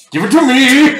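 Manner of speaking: shouted demand. Acted.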